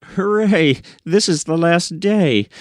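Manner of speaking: a sudden gleeful whoop, pitch jumping up; excited cheer. Acted. Woman rejoicing that the last day has come, excited high cheer. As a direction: burst out high with glee.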